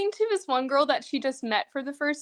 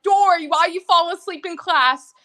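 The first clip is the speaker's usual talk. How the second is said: louder, higher mock teacher; scolding teacher; 5 semitones higher, 7 dB louder than usual. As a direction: call out a sleeping pupil. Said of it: "and the teacher's like".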